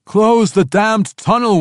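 high, slow, loud urgent shout. Acted. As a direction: bellow the order to seal it. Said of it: "went the shouts".

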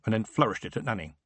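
plain reading voice

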